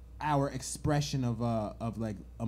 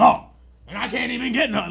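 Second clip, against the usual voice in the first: high, loud whine of a frustrated man; whiny frustrated man; 9 semitones higher, 10 dB louder than usual. Retold or imitated. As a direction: complain like a sulky boyfriend. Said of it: "so he's gonna be like".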